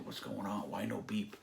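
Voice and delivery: hushed, anxious whisper; worried hush while waiting for news; whispered worry. Retold or imitated. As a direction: breathe it out nervously.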